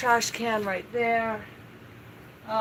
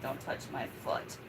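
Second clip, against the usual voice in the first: whispered dog voice; 9 semitones lower, 11 dB quieter than usual. Retold or imitated. Voicing the dog's complaint.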